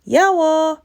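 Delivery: high, loud cheery shout; shouting goodbye. Acted. Boy shouts goodbye to his sister as he dashes off, loud. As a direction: fling it back as he runs off. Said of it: "shouted Bala".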